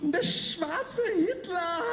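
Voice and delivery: very slow, high, stunned; horrified realization. Retold or imitated. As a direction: breathe it out in disbelief. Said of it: "she said".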